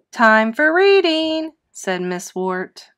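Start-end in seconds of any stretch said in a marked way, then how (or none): 0.1-1.5 teacher announcing. Acted.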